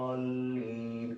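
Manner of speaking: दालीन with a very long, level aa on दा; long madd demo. Shown as a demonstration. Teacher shows what the madd letter causes, greatly prolonged दालीन. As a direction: hold दा on one steady note, then stretch ली. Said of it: "क्यों पढ़ते हैं".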